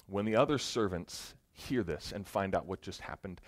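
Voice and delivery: ordinary talk, nothing marked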